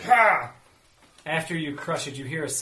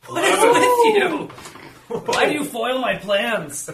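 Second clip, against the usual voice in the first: villain voice; 11 semitones higher, 9 dB louder, slower than usual. Acted. GM answers as zombie villain.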